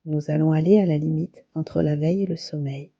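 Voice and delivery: calm, unhurried yoga nidra guidance with long pauses; guided meditation. Acted. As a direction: guide them gently into relaxation, phrase by phrase. (voix posée de méditation guidée, avec de longues pauses)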